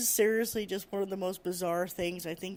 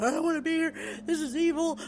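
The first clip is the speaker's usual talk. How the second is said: much higher, louder imitation of a panicked coworker; freaked-out coworker; 9 semitones higher, 5 dB louder than usual. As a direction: squeal it like she can't stand to be there. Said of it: "she's freaked out".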